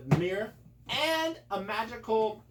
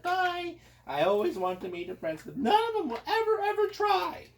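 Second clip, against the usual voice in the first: much higher, plaintive princess; whiny princess voice; 14 semitones higher than usual. Acted. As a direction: pine for a prince in a high voice. Princess laments absent princes.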